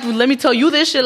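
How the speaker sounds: ordinary delivery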